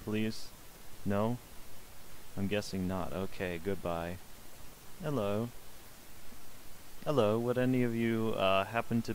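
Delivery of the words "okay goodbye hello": ordinary delivery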